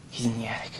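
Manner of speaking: hushed frightened whisper. Real, not acted. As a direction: whisper so he won't hear. Hiding from intruder, hushed.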